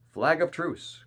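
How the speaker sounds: high, loud call across a distance; crying out. A narrator's reading. Silver answers the captain's challenge, crying out. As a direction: call it out to be heard from afar. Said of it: "cried Silver".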